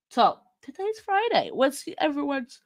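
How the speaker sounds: talking through a yawn; words stretched by a yawn. Real, not acted. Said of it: "sorry, the yawning is back".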